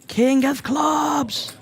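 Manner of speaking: high, very slow, longing call; drawn-out hope. Real, not acted. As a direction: stretch it out hoping for the card. Commentator wishes for the card on the river.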